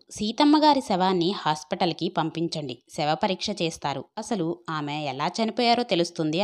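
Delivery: ordinary delivery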